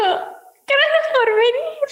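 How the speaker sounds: very high, slow, stretched thanks; sweet idol thanks. Retold or imitated. Idol thanking fan before jumping, very high and slow. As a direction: draw it out sweetly. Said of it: "Y me dijo".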